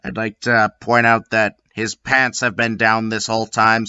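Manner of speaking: plain reading voice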